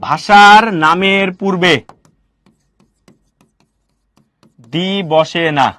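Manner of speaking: slow, word-by-word "ভাষার নামের পূর্বে দি বসে না"; each word of "ভাষার নামের পূর্বে" stretched out, then "দি বসে না"; slow emphatic teaching. Shown as a demonstration. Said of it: "ভালো করে শিখে রাখো".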